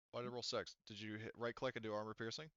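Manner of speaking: ordinary delivery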